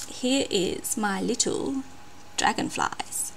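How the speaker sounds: soft, breathy reveal; whispered reveal. Real, not acted. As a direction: whisper it like a secret.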